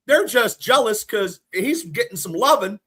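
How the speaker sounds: usual speaking voice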